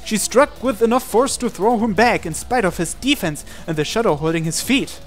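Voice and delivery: normal talking voice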